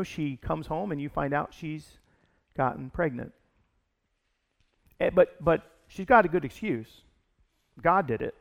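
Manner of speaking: normal talking voice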